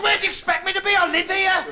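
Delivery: high, indignant comeback; indignant retort. Acted. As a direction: answer back high, as if it were obvious. Indignant reply in doorstep quarrel.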